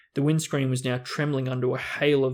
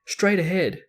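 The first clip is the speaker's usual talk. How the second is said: raised, eager call; excited call; 5 semitones higher, 4 dB louder than usual. Acted. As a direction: call it out urgently. Harry calling out the castle.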